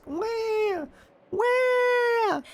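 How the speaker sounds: hammed-up baby wail; mock wailing. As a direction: ham up the crying. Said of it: "here come the waterworks".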